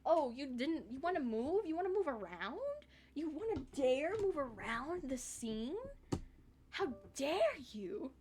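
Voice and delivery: high, sing-song taunting, slowing at the end; mock outrage. Streamer imagining the software's taunt, high mock outrage. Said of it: "I'm so mad right now".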